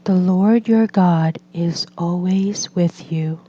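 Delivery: ordinary delivery, nothing marked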